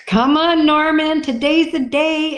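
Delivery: high, cheering on a lazy bull; pep talk to a lazy bull; encouraging. Retold or imitated. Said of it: "I have to encourage him".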